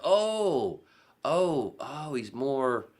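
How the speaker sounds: high, drawn-out ohs; dawning realization. Retold or imitated. Retelling being turned on to the show, dawning realization.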